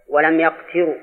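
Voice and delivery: يقتروا read yaqtirū; first of three readings. Shown as a demonstration. Teacher lists three readings, here يقتروا as yaqtirū. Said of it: "فتكون قراءات على هذا".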